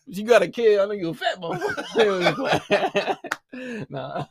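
ordinary talk, nothing marked

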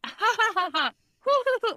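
high fake ha-ha climbing into hoots; contrived fake laughter. Therapist laughing absurdly as others overhear, fake laughter. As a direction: put on silly laughter, rising to hoo-hoo. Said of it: "my contrived fake laughter".

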